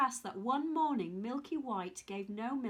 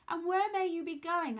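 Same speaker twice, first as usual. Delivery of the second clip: put-on peculiar old man; 6 semitones higher, 5 dB louder than usual.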